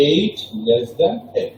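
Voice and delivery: careful classroom reading; slow model pronunciation; slow demo reading. Shown as a demonstration. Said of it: "সঠিকভাবে বাম থেকে ডানে উচ্চারণ করে আসি".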